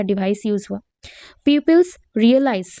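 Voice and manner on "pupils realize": slow dictation. Shown as a demonstration. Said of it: "teacher dictating definition".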